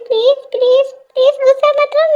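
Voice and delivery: whiny please-please begging; pleading husband. Acted. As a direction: beg her not to be angry. Husband begging angry wife.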